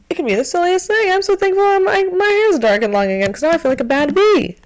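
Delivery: a giddy rush of happiness over the little things; giddy excitement. Real, not acted. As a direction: gush about it, nearly laughing. Gushing about silly things she's thankful for.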